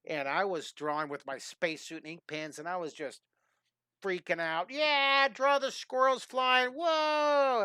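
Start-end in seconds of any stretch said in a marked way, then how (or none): 4.7-7.6 loud and high, the words squeezed out with excitement; loud excited yell. Retold or imitated. Retelling himself yelling at Bob Ross's demo, loud and high. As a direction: whoop like an overexcited kid at a live taping. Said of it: "I was really loud".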